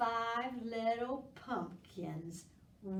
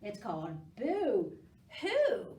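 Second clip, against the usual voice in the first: ordinary talk, nothing marked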